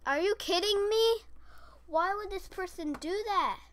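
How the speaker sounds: loud, high-pitched disbelief; exasperated outburst. Real, not acted. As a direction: cry out in frustration at another player. Frustrated outburst at another player.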